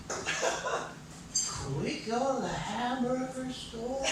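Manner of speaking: put-on high, soft, slow little-kid plea; four-year-old child. Four-year-old asking Mommy for hamburgers, imitated child voice. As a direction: ask it like a small child, rising at the end. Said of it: "That's what a four-year-old says".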